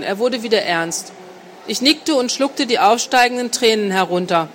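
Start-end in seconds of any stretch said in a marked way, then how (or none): none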